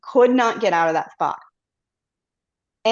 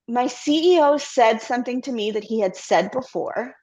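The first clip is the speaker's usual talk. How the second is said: ordinary delivery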